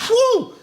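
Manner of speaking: high, loud whew; relieved stag whoop. Acted. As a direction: let out a big comic whew. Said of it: "and he goes".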